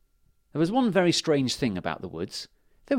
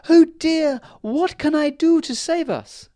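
high, loud fairy voice on the oh dear; fairy queen desperate to save her people; distressed Fairy Queen; 9 dB louder than usual. Acted. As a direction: fret in a tiny queen's voice.

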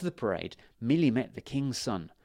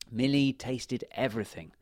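plain reading voice

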